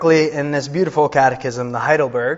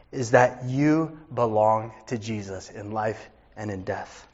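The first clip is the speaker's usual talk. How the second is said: usual speaking voice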